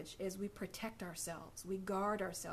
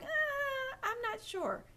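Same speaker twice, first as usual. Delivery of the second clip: doubtful listener; slower than usual. Pretend.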